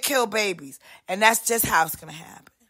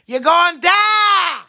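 slow, higher, louder yell of die; shouted doom; 6 semitones higher, 5 dB louder than usual. Acted. Shouting at someone they will die. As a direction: bellow the doom.

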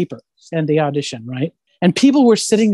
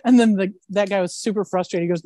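ordinary delivery

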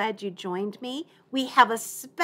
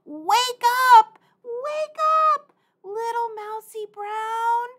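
high, loud wake-up call; 13 semitones higher, 7 dB louder than usual. Shown as a demonstration. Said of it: "We better do it again".

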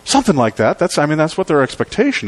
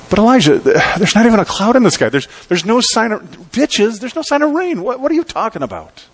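higher, stammering disbelief; incredulous kings; 8 semitones higher than usual. Retold or imitated. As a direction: splutter like a king who can't believe it. Kings object, no rain.

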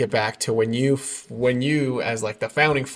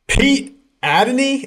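big, high, loud show-host intro; announcer introduction; 14 semitones higher, 8 dB louder, much slower than usual. Real, not acted. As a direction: draw out the name like a ring announcer.

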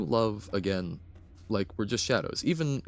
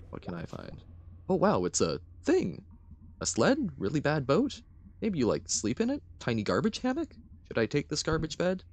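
lightly voiced higher questioning voice; May, curious; 8 semitones higher than usual. May rummages through the garbage island, higher curious voice. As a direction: puzzle aloud with rising curiosity.

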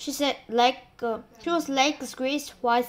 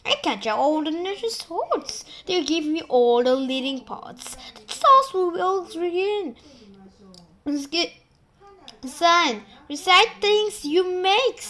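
higher, louder gushing aunt; vain aunt Sponge; 5 semitones higher, 5 dB louder than usual. Acted. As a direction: gush over yourself loudly. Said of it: "and Sponge declared".

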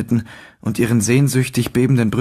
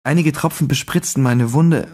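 ordinary delivery, nothing marked

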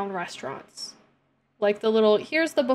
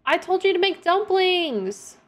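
higher, mock scolding shout; exasperated yell; 8 semitones higher than usual. Real, not acted. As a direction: scold the Sim for failing. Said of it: "I'll stop yelling".

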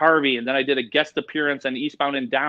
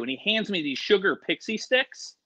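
ordinary talk

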